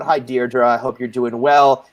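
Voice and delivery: ordinary talk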